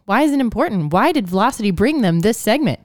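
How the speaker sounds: bouncy scripted hand-off to a co-host; ad read. Acted.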